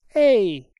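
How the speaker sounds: loud sudden call; a guard calling out at the window; exclamation. A narrator's reading. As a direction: call out sharply to get attention. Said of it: "esclamò una voce".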